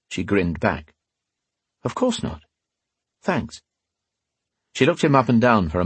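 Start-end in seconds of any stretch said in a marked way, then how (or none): 1.8-3.6 high sweet woman. A narrator's reading. Catherine voiced, soft.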